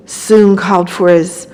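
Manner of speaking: ordinary delivery, nothing marked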